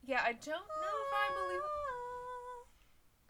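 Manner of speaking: high slow wailing cry; banshee wail. Pretend. Jokey wail after banshee mention.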